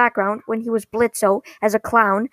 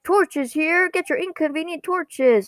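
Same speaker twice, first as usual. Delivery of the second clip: high, sing-out hawker's call; imitating a vendor's cry; street vendor; 6 semitones higher than usual. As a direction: hawk your wares to passersby. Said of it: "it cuts to a guy saying".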